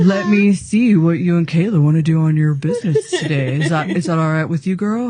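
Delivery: loud imitation of her AI.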